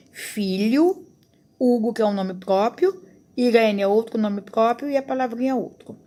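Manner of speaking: ordinary talk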